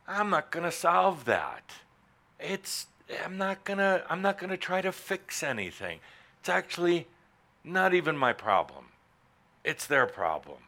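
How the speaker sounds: a lightly voiced, relieved giving-up; relieved listener voice. Speaker voicing the audience member dropping their burden, relieved. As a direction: give up with a light sigh of relief. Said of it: "And you go".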